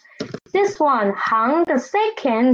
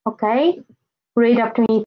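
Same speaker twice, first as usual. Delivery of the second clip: usual speaking voice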